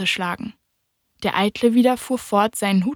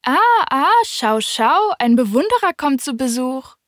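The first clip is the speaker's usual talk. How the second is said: higher, louder delighted call; calling from afar; 5 semitones higher, 3 dB louder than usual. Acted. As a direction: call out gleefully to the arriving admirer. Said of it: "rief der Eitle von weitem".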